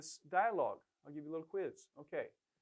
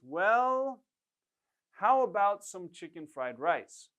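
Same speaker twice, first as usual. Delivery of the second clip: hesitant suggestion; 7 dB louder, slower than usual. Shown as a demonstration. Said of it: "you would often use this expression".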